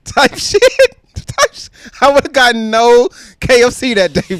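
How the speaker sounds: words squeezed out through tears of laughter; crying with laughter, high and loud. Real, not acted. Laughing so hard it sounds like crying.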